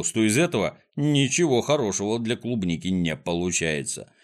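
ordinary delivery, nothing marked

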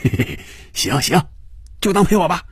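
sly chuckle, then high, gleeful agreement; chuckling. A narrator's reading. Fan Yuzhou pleased his partner will join him, chuckling. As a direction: chuckle first, then pitch it up merrily. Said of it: "范宇宙笑着说".

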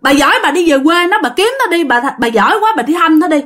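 a younger sister yelling at her friend, retold as a mock yell. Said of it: "nó mới la làng lên".

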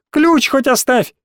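high, stretched-out plea; desperate cry. A narrator's reading. Small boy calls after fleeing mate, desperate cry. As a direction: call it after him slowly, pitched high. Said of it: "крикнул осипший мелкий".

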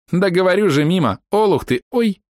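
high mocking shout ending 'Ой'; taunt then yelp. Archer girl jeers then yelps when hit. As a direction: jeer then squeak.

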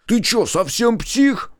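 high, drawn-out outraged protest; indignant. A narrator's reading. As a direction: stretch it out in a high, offended cry. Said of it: "возмутился он". (возмущённо, высоким голосом, протяжно)

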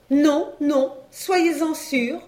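high-pitched, emphatic assurance; eager wife. A narrator's reading. Wife assuring the dealer about the cow, eager. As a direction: lift the voice high and draw out the promise.

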